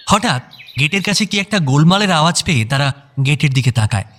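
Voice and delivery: usual speaking voice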